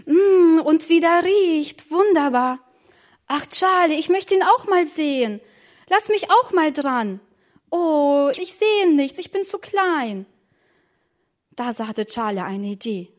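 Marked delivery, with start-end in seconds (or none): 0.1-2.6 high, drawn-out relish; savouring delight. Acted. Child savours smell of strudel. 3.3-10.3 high-pitched begging; childish pleading. Acted. Small child begs to see strudel.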